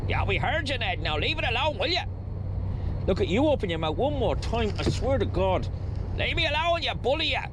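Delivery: high, squawky squabbling characters; squabbling pair in high put-on voices; bickering comic duo. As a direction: act out a petty back-and-forth in high swooping voices. Acts out bickering Ned and Mo.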